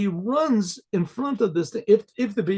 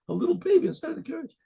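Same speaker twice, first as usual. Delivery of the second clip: put-on "little baby" repeated much higher and fast; amazed repeat; 11 semitones higher, faster than usual. Shown as a demonstration. Storyteller marvels at the baby in the carriage, higher and faster. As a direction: replay it high and quick, full of wonder. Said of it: "and sure enough, there's a little baby".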